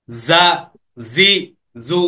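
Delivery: measured, unhurried syllables. Shown as a demonstration. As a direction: say জা, জি, জু one by one with a pause after each. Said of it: "এত দ্রুত না".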